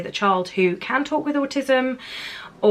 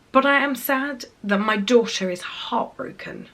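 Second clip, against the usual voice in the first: ordinary talk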